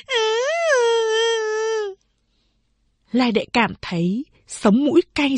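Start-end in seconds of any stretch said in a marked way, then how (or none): 0.1-1.9 put-on high, whimpering child's wail; a small girl sobbing for her mother; wailing little child. A narrator's reading. Youngest sister wailing in big sister's arms, crying child voice. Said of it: "Cầu Đệ gào khóc".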